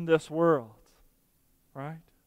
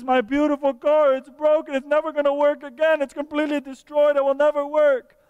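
put-on high, loud wail of a heartbroken little boy; hammed-up child despairing over his broken toy; crying little boy; 8 semitones higher, 4 dB louder than usual. Little boy wailing to Daddy over his broken Lego car, high and loud. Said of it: "he falls apart and he's crying".

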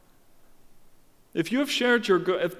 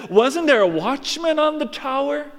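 accusing crowd; 9 semitones higher, 4 dB louder than usual. Acted. People voiced accusing.

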